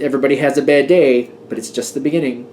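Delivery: ordinary talk, nothing marked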